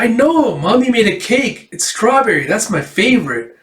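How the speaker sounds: put-on high-pitched, gushing child voice; excited little girl. Birthday girl tells dad about cake, excited. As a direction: bubble over like an excited kid.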